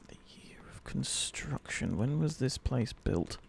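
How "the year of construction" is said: soft unvoiced whisper to herself. Real, not acted. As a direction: breathe it under your breath. Muttering clue to herself.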